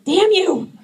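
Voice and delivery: hammed-up mock outrage; mock curse. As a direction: shake a fist at the perfect moms. Mock cursing the Pinterest moms.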